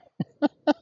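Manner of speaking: short high real laugh. Real, not acted. Vlogger laughing at his own claim, short high real laugh. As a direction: burst out laughing at yourself.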